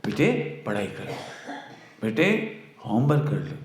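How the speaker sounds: loving mother. Retold or imitated. Mother lovingly urging son to do homework, soft coaxing. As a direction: gently coax a son to study. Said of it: "माँ बेटे को बड़े प्यार से कहता है".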